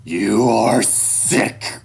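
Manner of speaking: very slow, loud condemnation; father's loud, deliberate disgust; hateful. A narrator's reading. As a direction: draw out each word with loathing. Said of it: "speak with so much hate before".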